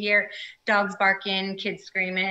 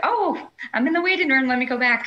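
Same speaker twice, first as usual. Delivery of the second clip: higher-pitched retold "oh" of surprise; sudden realization; 6 semitones higher, 4 dB louder than usual. Guest retells realizing she was in the waiting room, surprised and higher. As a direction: re-enact a little jolt of alarm, pitched up.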